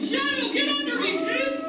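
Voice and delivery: high, loud urgent warning; alarmed call. Acted. Teacher Manta warning the pups to hide, high and loud. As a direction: shout the kids to cover.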